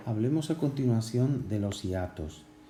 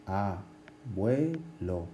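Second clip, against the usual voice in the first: abuelo drawn out very slowly, each syllable apart; teacher stretching abuelo to show the diphthong; much slower than usual. Shown as a demonstration. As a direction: spell out abuelo slowly by sound.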